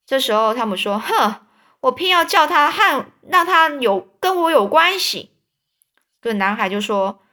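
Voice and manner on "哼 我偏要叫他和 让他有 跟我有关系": higher and louder, huffy and stubborn; defiant huff. Acted. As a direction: snort then insist. Tom defiantly insists.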